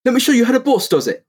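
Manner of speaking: high cocky hero voice; Phoenix impression. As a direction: brag like a swaggering agent. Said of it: "That's Phoenix".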